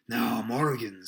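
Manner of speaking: loud, stern seaman's address. A narrator's reading. Long John confronts Morgan, very stern. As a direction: call him to account firmly. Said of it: "said Long John very sternly".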